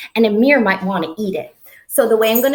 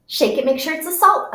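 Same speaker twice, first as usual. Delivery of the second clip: ordinary talk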